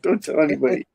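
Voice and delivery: giggly confidential aside; laughing whisper-secret. Retold or imitated. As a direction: share the secret through laughter.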